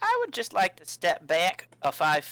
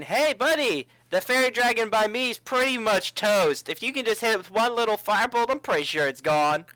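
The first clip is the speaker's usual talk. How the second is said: raised, higher call to an ally; yelling over; 6 semitones higher, 4 dB louder than usual. Acted. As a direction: call out across the fight, slowing it down. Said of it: "You yell over to Swain".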